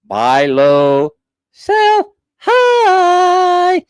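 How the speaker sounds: high swooping climb on "high"; lecturing the clerk, voice soaring on high; sing-song finish. Retold or imitated. A mocking sing-song lesson to the bank clerk.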